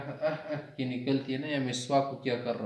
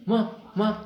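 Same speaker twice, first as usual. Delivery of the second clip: ماہ، ماہ called out high and loud; sharp stop call; 9 semitones higher, 8 dB louder than usual. Shown as a demonstration. Teacher showing the Arabic stop word, loud call. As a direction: bark ماہ to halt someone. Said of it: "اک پک، رک جا،".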